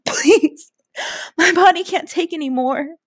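high, moaning plea; moaned plea. A narrator's reading. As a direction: moan it out, begging. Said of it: "I moaned".

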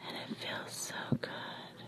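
very soft, breath-only whisper; hushed whisper. A narrator's reading. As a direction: let it fade into a whisper. Intimate aside in narration.

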